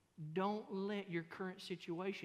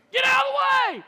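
a much higher, much louder yell at another driver; road rage yell; 22 dB louder, faster than usual. Retold or imitated. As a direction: blast it at the car ahead. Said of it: "by yelling at 'em".

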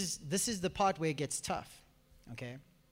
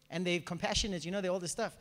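usual speaking voice

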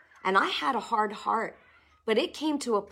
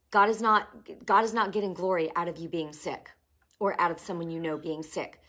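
ordinary delivery

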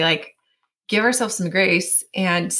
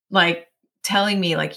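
normal talking voice

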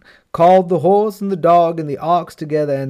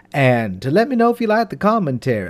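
ordinary delivery, nothing marked

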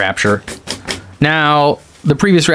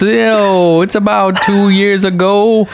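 higher, drawn-out mock street slang; put-on hip talk, pitched up; mock hip Bible; 7 semitones higher, slower than usual. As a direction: parody a hip Bible paraphrase. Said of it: "It's like hip".

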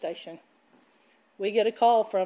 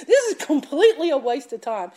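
laughing at your own doubt; laughing at self; 6 dB louder than usual. Retold or imitated. Preacher laughing at her own thought of giving up. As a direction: say it through a laugh, louder. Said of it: "you know what I was telling myself".

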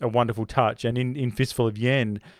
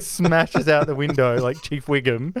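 ordinary talk, nothing marked

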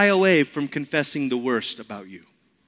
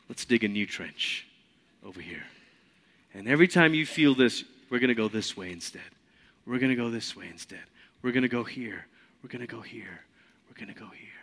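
soft and gentle, growing quieter each line; quiet voice of Jesus; 5 semitones lower, 7 dB quieter, faster than usual. Retold or imitated. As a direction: coax quietly, fading to almost nothing.

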